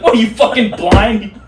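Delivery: high, loud, slow mock yell; rude yell. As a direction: yell it through laughter, teasing. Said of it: "Instead of being like".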